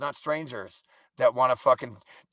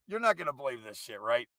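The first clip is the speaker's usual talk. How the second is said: ordinary delivery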